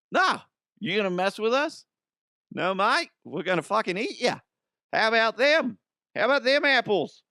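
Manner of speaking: high-pitched mock Aussie tough talk; defiant townsfolk. Voices defiant Australian townspeople.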